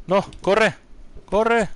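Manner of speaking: high, loud urgent yelling; panicked shout. Real, not acted. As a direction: yell at yourself to run. Fleeing an enemy in game.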